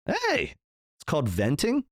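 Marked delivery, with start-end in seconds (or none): 0.1-0.5 playful protest. Real, not acted. Defending swearing as venting.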